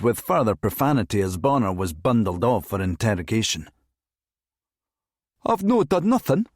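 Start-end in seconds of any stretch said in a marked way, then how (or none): 5.4-6.5 high-pitched Scots protest of innocence; aggrieved complaint. A narrator's reading. Driver bundled off for interrogation, complaining. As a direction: complain indignantly, voice pitched right up. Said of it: "he complained".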